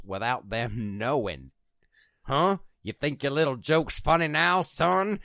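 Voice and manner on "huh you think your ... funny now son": tough cop voice. Acted. Mock threatening cop.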